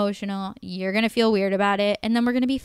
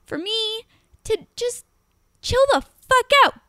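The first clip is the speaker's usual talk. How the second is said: exasperated high rant; 13 semitones higher than usual. Real, not acted. Woman venting to the host about her date, exasperated squeal. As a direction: vent in exasperation, voice shooting up.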